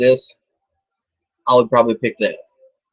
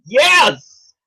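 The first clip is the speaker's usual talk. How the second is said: triumphant yell with a laugh; excited shout; 6 dB louder, much slower than usual. Real, not acted. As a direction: shout in victory.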